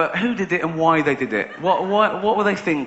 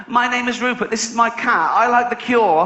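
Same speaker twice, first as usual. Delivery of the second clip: higher, earnest little self-introduction; mock website owner; 6 semitones higher, 3 dB louder than usual. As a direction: play a dull oversharer, voice raised. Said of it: "It's like".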